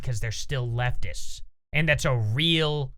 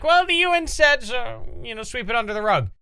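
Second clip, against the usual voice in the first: mocking Cenk; 6 semitones higher than usual. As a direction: parody him in a high, dismissive whine. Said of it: "and he's like".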